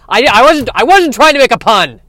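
high, loud, defensive protest; flustered eel. Acted. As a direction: deny it in a fluster.

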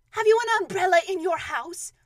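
high, loud question from above; calling down. Acted. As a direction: call the question down to someone below. Pooh calls down from balloon.

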